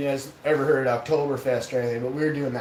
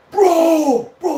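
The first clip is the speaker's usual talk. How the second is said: loud, high re-enacted outburst of awe; amazed exclamation; 13 semitones higher, 11 dB louder than usual. Blown away by the moon's craters, loud amazement.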